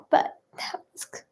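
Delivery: breathy whisper, cut off mid-word; whispered aside. Real, not acted. Vlogger confiding about an ex's gift, whispered. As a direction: drop to a secret whisper and stop short.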